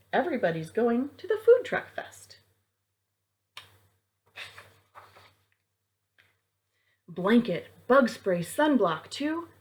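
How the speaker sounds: lively rhyming storybook read; reading to children. A narrator's reading. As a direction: bounce through the rhymes for kids. Reads picture book aloud.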